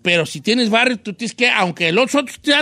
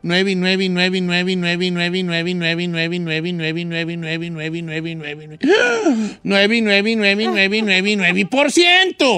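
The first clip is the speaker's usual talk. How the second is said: droning repetition; 3 semitones lower, slower than usual. Pretend. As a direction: trail off the nines then land the punchline. Endless nines for comic effect.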